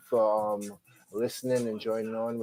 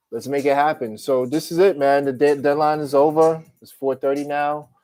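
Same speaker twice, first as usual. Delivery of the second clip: excited; 4 semitones higher, 8 dB louder than usual. Real, not acted. Deadline day excitement.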